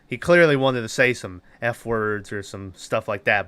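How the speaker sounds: ordinary talk, nothing marked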